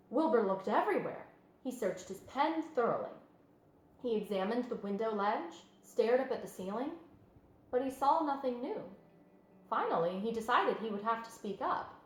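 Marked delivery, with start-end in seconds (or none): none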